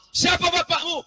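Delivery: high loud demand; loud question. Acted. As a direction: Juraij loudly asks the baby. (Juraij bertanya keras kepada bayi)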